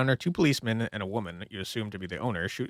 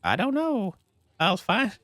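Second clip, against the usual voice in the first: put-on higher child voice; 9 semitones higher, slower than usual. Little girl answering her mother, higher child voice. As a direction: talk like a little kid. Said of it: "my child and woman voice are not very different".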